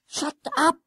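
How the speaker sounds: high, urgent hiss. A narrator's reading. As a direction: silence your friend sharply without shouting. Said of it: "hissed Jennings".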